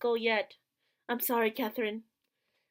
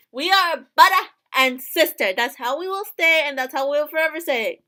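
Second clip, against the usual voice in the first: firm insistence; 3 semitones higher, 9 dB louder than usual. Acted. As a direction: insist through tears, voice raised. Character insisting on sibling bond.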